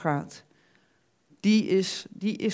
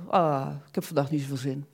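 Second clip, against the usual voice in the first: mock lazy, lower, idle voice; lazy God; 4 semitones lower than usual. Preacher mocking a passive idle God, lightly voiced, lower. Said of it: "passieve God die zoiets van".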